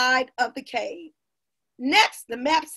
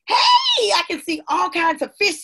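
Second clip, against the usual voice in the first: excited discovery; 6 semitones higher, 5 dB louder than usual. A narrator's reading. Amma spotting fish through the boat's glass bottom, excited. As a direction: cry out at a thrilling sight.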